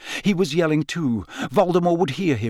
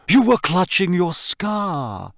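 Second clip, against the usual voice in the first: lightly theatrical, higher and slow; insistent professor; 5 semitones higher, slower than usual. Acted. Trelawney pressing Harry about his scar, theatrically insistent. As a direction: insist with drawn-out, dramatic words.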